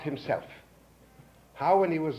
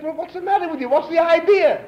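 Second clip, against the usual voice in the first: much higher, louder exasperated outburst; outraged protest; 12 dB louder than usual. Retold or imitated. Guest shouting at the host who refuses his money, exasperated. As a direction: blow up in disbelief.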